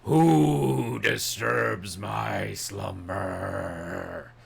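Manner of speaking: very slow, grinding stone statue rumble; slow dragon voice. Acted. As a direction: rumble it out like an ancient beast. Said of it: "and you hear".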